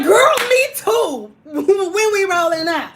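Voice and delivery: high, slow, drawn-out sassy friend; eager girlfriend voice. Retold or imitated. Streamer voicing a viewer who agrees, high and slow. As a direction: stretch it out like a hyped bestie.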